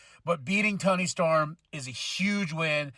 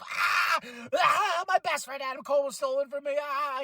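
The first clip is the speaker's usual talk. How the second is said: high, fast whining rant of a sulking wrestler; mock a sore loser's wail, fast; whiny mock tantrum; 6 semitones higher than usual. Imitating Roderick Strong whining over Adam Cole, high and fast. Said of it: "He's like".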